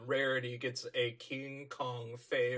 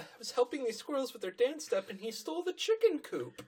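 much higher, fast, fretful; timid pony; 12 semitones higher, faster than usual. Retold or imitated. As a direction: tell it in a worried rush. Fluttershy voiced, distressed.

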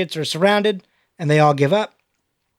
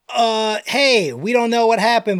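usual speaking voice

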